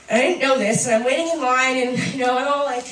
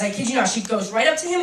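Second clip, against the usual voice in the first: usual speaking voice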